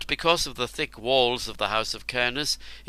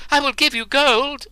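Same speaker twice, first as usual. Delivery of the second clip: desperate bribe; 9 dB louder than usual. A narrator's reading.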